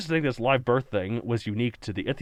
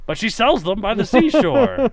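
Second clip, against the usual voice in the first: playful; 9 semitones higher, 6 dB louder than usual. Real, not acted. Playful punchline retort.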